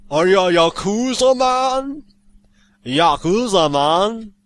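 high, drawn-out mock Jamaican accent. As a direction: put on a playful Jamaican lilt. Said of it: "it sounds like a Jamaican".

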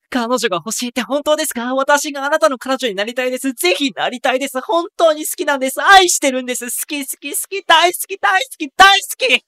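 put-on high girl's voice building from shy to a loud gushing cry; high girlish confession swelling to a loud outburst; love letter girl.

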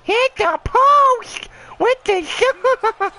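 hammed-up high, slow echo of the commentator's call; mocking the announcer. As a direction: parrot the announcer in a silly high voice. Mocks the announcer's line.

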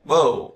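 "Boat" with voiced B and a gliding ơ u vowel; model pronunciation. Shown as a demonstration. English teacher modelling "Boat" for students to repeat. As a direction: say "Boat" slowly, voicing the B. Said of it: "cố gắng đọc nhá".

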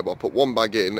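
usual speaking voice

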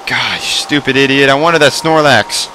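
ordinary talk

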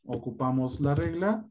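ordinary delivery

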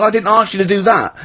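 high-pitched mock objection; mock protest. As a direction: protest as the startled emperor. Acts Nero protesting in rant.

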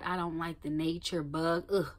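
ordinary talk, nothing marked

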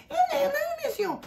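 fast, very high squeaky voice-changer voice; a rushed chipmunk denial. Retold or imitated. Suspect denying to police, sped-up voice-changer squeak. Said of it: "ボイスチェンジャーでね".

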